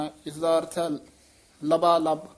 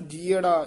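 ਜੀਅੜਾ said louder with a falling stress; 4 dB louder than usual. Shown as a demonstration. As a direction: hit ਜੀਅੜਾ firmly. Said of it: "ਇੱਥੇ ਯਮਕ ਲੱਗ ਜਾਏਗੀ". (ਜੀਅੜਾ ਤੇ ਯਮਕ)

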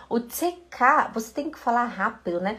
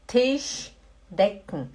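slow demo; 2 semitones lower, much slower than usual. Shown as a demonstration. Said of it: "pronunciation demo".

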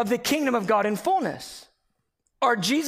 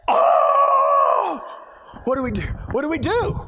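loud high yelp of mock panic; freaking out; 7 semitones higher, 6 dB louder than usual. Husband hearing his wife is in labor, hammed-up freak-out. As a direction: re-enact panic, a shriek then frantic questions. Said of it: "I started freaking out".

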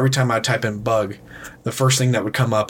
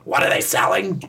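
put-on high, shrieking old lady voice; SpongeBob chocolate lady. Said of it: "the great-grandmother from, uh, fucking SpongeBob".

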